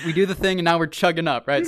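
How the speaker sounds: usual speaking voice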